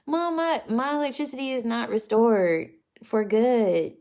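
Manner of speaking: high, loud whine to mom; exasperated protest. Real, not acted. Daughter setting mom straight about her electricity, exasperated protest. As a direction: correct Mom with loud, whiny insistence. Correcting mom's mistaken comment.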